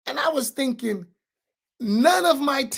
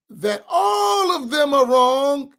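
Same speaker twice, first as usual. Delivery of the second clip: tearful outburst; 4 semitones higher, 6 dB louder, much slower than usual. Real, not acted. Emotional about cult leader.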